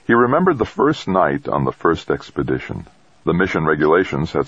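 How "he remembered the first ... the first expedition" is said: ordinary delivery, nothing marked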